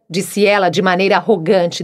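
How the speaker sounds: plain reading voice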